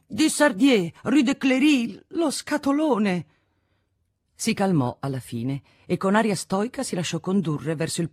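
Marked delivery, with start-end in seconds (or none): 0.1-3.3 high, stretched-out cries; high-pitched, dogged protest; insistent repeating. A narrator's reading. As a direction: keep calling out the same details stubbornly. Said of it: "E ripeteva".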